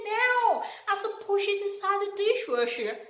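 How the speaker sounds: put-on high American-accented voice, the host insisting; American accent, high and insistent; American accent imitation. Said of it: "speaking in her accent".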